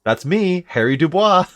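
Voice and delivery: high, hammed-up self-introduction; playful self-introduction. Player hammily greets character's own reflection.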